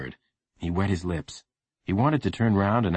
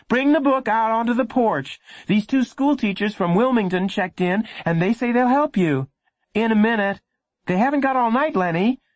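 raised, impatient mother voice; impatient mom; 4 dB louder than usual. Acted. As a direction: hurry him along.

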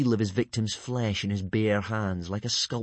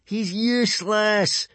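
high, loud, drawn-out jeer; mocking singsong gloat; gleeful crowing; 12 semitones higher, 5 dB louder, slower than usual. A narrator's reading. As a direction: crow it in triumph. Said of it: "crowed Snotlout gleefully".

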